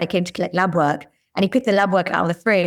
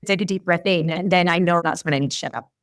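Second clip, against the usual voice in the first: usual speaking voice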